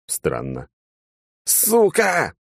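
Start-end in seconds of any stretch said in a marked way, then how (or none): 1.4-2.3 high yelp of pain, one sharp shout; sudden pained outburst; yelled curse. A narrator's reading. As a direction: scream the curse as the ear is cut off. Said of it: "я заорал".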